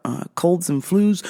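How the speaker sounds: ordinary talk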